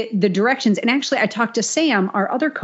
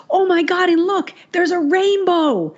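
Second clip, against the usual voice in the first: awestruck gush; 11 semitones higher than usual. Retold or imitated. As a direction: coo it like a thrilled friend spotting a rainbow. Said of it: "she goes".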